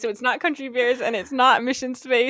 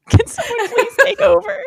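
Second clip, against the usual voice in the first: much higher, louder pleading voice; mock plea; 11 semitones higher, 5 dB louder than usual. Friend hamming up the clue in a high plea. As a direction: squeak it out like a desperate worker. Acting out the clue line again.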